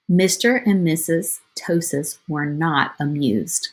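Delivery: ordinary delivery, nothing marked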